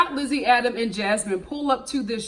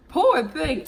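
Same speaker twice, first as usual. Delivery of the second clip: mock pity; 5 semitones higher than usual. Real, not acted. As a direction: pity him with a sarcastic sigh.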